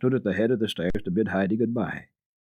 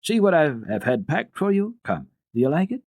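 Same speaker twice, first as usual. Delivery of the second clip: put-on higher, eager child's voice; excited girl; 10 semitones higher, 4 dB louder than usual. A narrator's reading. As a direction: show off the presents with delight. Clara eagerly shows packed gifts.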